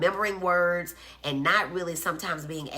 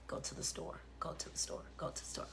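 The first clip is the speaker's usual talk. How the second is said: soft whisper repeated, fading away; faint whispered echo trailing off; 16 dB quieter, faster than usual. Shown as a demonstration. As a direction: whisper it back like a distant echo. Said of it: "Or sometime later".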